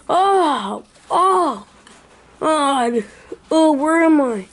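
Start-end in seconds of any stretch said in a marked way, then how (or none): none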